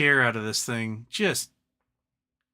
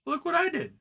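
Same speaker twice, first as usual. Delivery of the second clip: mock proud retail buyer; 9 semitones higher than usual. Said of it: "It's just like saying".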